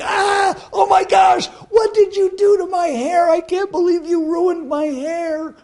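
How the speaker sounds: high, loud horrified yell at the mirror; screaming in horror over a ruined haircut; shocked shout. Retold or imitated. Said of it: "and I'm like".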